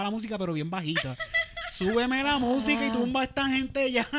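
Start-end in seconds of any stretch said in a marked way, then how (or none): 1.7-4.1 high, pushy mock yell at the bar; mock heckler yell. Heckler at the billiards orders the bar to drown out the show, mock yell. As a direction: boss the bartender around, hammed up. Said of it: "le está gritando".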